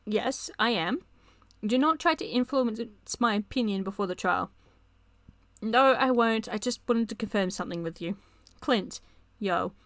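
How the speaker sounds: ordinary talk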